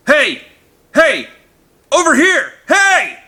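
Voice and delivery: high, loud desperate yells; frantic yelling. A narrator's reading. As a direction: wave him down, yelling. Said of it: "He waved frantically and yelled".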